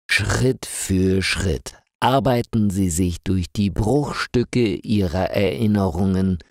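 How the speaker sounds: usual speaking voice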